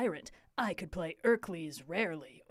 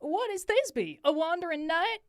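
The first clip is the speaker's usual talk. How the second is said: higher, louder youthful voice; high young Flute; 5 semitones higher, 3 dB louder than usual. Acted.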